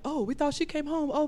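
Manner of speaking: high, put-on innocent excuse; sheepish brothers. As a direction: fib like guilty boys. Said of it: "they were like".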